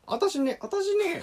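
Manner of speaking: high, soft teasing woman's voice; mock Kansai woman. Mimicking a Kansai woman to tease his friend, high and soft. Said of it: "みたいな".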